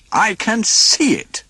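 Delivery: high, slow, cross retort; irritated snap. A narrator's reading. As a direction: snap back with sweaty annoyance. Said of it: "snapped Donald".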